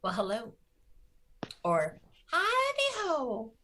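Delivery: high, slow, sing-song howdy-ho; high goofy voice. Acted. Greeting the podcast hosts in a high, drawn-out goofy voice. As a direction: stretch it out way up high like a cartoon. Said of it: "That's how she sounds also all the time".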